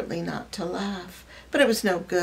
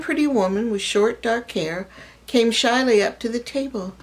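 plain reading voice

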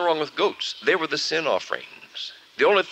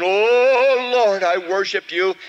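louder, higher cry of Oh Lord; fervent prayer; 7 semitones higher, 6 dB louder, much slower than usual. Acted. The preacher tries again to worship, loud high praise.

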